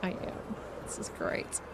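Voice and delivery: whispered flat happiness; deadpan whisper. Real, not acted. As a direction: breathe it out flat. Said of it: "in my very lovely monotone voice".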